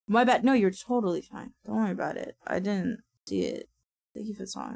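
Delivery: normal talking voice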